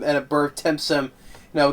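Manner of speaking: ordinary talk, nothing marked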